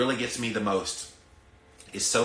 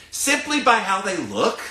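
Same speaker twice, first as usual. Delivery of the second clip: indignant cry, higher and louder; outraged outburst; 10 semitones higher, 8 dB louder than usual. Real, not acted. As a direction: voice jumps high and loud in indignation. Indignant rant about excluding people.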